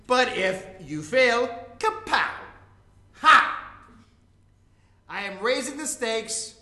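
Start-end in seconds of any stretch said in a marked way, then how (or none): 3.2-3.6 one loud gloating "Ha!"; villain's ha. Acted. Villain gloats at his rival spy, loud triumphant ha. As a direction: crow a single triumphant ha, louder.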